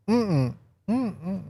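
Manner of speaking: high, soft "mm-mm" with lips shut; closed-mouth refusal hum. Acted. Preacher plays Yeshua refusing Herod, humming a soft refusal. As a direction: hum a little no, twice.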